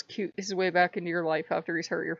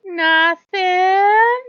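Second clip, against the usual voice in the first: much higher and louder, fake innocent; innocent child; 11 semitones higher, 11 dB louder than usual. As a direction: squeak it out like a guilty kid. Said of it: "his mother's like".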